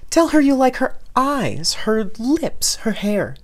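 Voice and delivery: high, loud frantic mother; panicked. Acted. Mother gushing advice.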